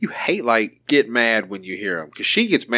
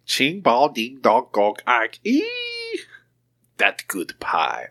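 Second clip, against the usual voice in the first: slow, exaggerated imitation of a mock-Chinese lyric; hammed-up fake Chinese accent; 2 semitones higher, slower than usual. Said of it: "Rock was talking Chinese".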